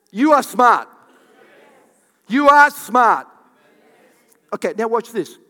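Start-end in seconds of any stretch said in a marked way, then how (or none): 0.1-0.9 "are" stretched in a loud, higher declaration; emphatic insistence. Real, not acted. Insists to audience after weak response. 2.3-3.3 "are" punched very loud and higher; emphatic insistence. Real, not acted. Repeats louder to the audience.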